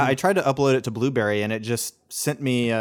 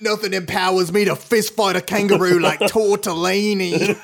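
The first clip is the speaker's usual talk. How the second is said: higher, louder hammed-up Aussie, laughing through it; Australian accent; 7 semitones higher, 5 dB louder than usual. Cohost escalating the joke, loud laughing Australian accent. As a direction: brag like a tough outback bloke. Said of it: "saying Italian dishes in an Australian accent".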